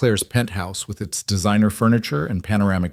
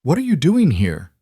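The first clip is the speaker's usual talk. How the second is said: hard cold; 7 semitones higher than usual. Acted. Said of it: "his expression hardened".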